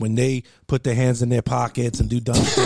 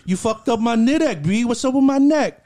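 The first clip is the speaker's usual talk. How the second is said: put-on much higher, slower whiny kid brother voice; complaining little brother; 9 semitones higher than usual. Injured brother complains to big brother about his neck, high and whiny. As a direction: play the hurt brother whining it up high.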